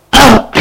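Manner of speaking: high sharp yelp; a sudden cry as she falls; high startled cry; cry of falling. Acted. Wife pushed to floor.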